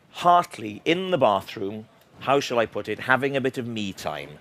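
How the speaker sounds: ordinary talk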